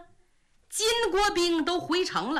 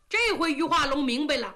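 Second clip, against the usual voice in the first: plain reading voice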